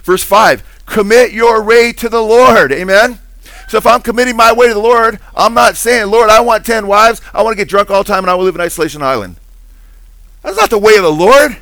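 high, loud, driving sermon voice; preaching at full force. Acted. Pastor urging congregation to trust the Lord, loud preaching. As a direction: press each verse home to the congregation.

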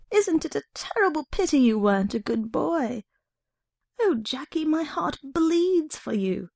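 a hint of a gloating sister feigning sorrow; false pity. A narrator's reading. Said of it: "the same regretful tone".

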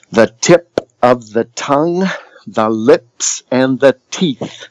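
crisp consonants on "tip", "tongue", "lips", "teeth"; over-articulate "tip", "tongue", "lips", "teeth", each set apart; exaggerated articulation. Shown as a demonstration. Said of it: "exaggerating the articulation".

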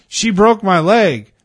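high, loud, drawn-out retold complaint; imitated drunken protest, slow and loud; mock loud protest. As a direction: wail the accusation, imitating him. Retold drunk man protesting loudly.

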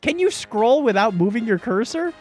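ordinary talk

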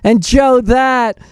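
mocking Kamala. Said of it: "she'd be going on and on".

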